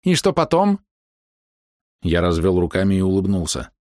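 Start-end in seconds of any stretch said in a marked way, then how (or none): 0.0-0.8 high, challenging question; high-pitched female query; high-voiced woman. A narrator's reading. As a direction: ask warily in a high voice. Hidden woman questions hero.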